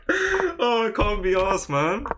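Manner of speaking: very high, loud groan sliding down; fed-up high-pitched lament; exasperated wail. Real, not acted. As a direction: whine it out, close to tears. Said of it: "I would start crying".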